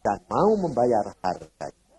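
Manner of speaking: normal talking voice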